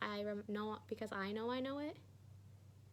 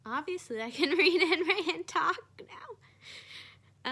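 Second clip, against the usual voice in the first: laughing; 7 semitones higher, 13 dB louder, much slower than usual. Real, not acted. Laughing at own recovery.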